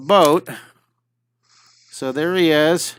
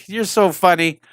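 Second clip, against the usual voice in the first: normal talking voice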